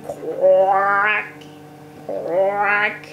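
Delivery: slow, low croaking sound; frog croak imitation.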